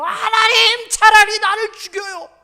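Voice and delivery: whiny desperate cry. Acted. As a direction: wail like a sulking prophet. Jonah quoted begging death. (요나처럼 높고 크게 떼쓰며 외치기)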